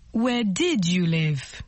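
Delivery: slow past-tense question; slow model phrase. Shown as a demonstration. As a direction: say it slowly, leaning on did. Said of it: "Le passé".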